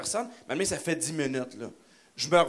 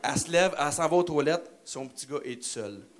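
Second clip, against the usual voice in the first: usual speaking voice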